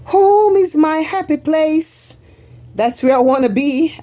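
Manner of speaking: delighted read-out of her home sign; sing-song sign reading. Pretend. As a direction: read the sign out like a cheerful jingle. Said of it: "and this is saying".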